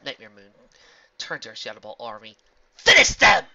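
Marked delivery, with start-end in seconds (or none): 2.8-3.5 high, loud villain command; commanding shout. Acted. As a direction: bark the order to attack.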